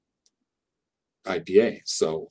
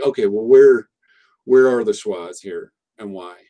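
quizzing the class; 9 dB louder than usual. Retold or imitated. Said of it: "be like".

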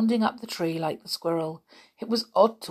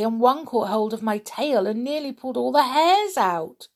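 high breathless little rabbit, much higher; 7 semitones higher, 4 dB louder than usual. Acted. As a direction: tell it like an upset little rabbit. The rabbit tells what happened.

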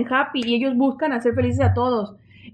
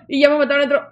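ordinary talk, nothing marked

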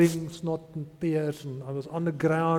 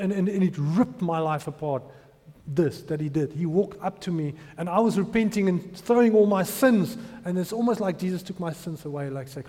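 normal talking voice

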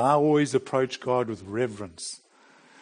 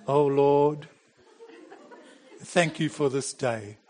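mock pious prayer. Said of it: "that's how we pray when we talk to God".

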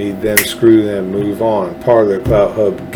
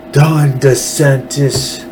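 slow, drawn-out New York Italian mobster voice; hammed-up New York mafia accent, stretching the name; 3 semitones higher, much slower than usual. Shown as a demonstration. Host naming the governor, in a not-so-good New York mafia voice. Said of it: "New York Italian mafia voice".

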